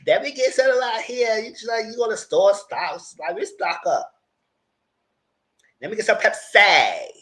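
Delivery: high, loud, hammed-up glee; playful high-pitched mock raid on snacks; mock excited shopper. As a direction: clown around as a greedy shopper. Clowning as a hungry store raider.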